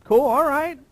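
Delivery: mock casual agreement. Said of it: "I'm like".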